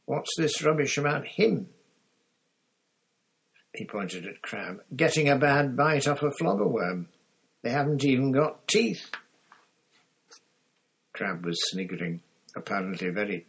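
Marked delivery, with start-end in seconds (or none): 0.0-1.7 higher, indignant demand; angry, pitched-up challenge; spat out angrily. A narrator's reading. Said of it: "Harry spat at Malfoy". 5.0-9.1 loud, high, scornful outrage; incredulous, pitched-up protest; spat out angrily. A narrator's reading. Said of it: "Harry spat at Malfoy".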